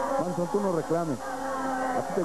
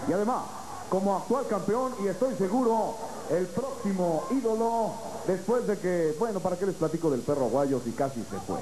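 high, drawn-out announcer build-up; lucha libre announcer; 4 semitones higher, slower than usual. Acted. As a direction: sell the champion to the crowd. Presenting the champion to the crowd.

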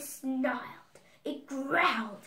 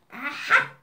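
witch's exclamation; 9 dB louder than usual. A narrator's reading. Baba Yaga spots the girl at her hut, loud exclamation. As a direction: pounce on it as the story's witch. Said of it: "said Baba Yaga".

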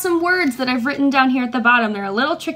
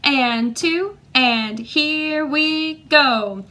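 slow, measured count-in on the beat; much slower than usual. Shown as a demonstration. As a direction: stretch each word to set the tempo. Teacher counts the class in.

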